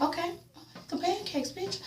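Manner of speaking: high, near-silent squeaky mock okay; tiny mock reply. Viewer mocking a diner threat, high and tiny. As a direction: shrink the voice like a scolded kid.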